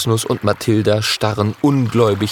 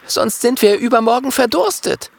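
much higher, bright little dragon voice; little dragon's voice; 13 semitones higher than usual. Acted. Little dragon tells friends they must dig for water. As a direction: voice the worried little dragon, pitched up.

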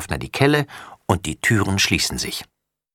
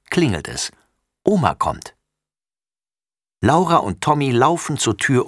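ordinary delivery

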